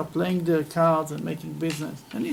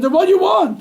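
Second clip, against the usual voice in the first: much higher, louder demand; exasperated demand; 12 semitones higher, 9 dB louder than usual. Acted. Businessman demands the price in frustration, high loud outburst. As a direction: burst out in frustration.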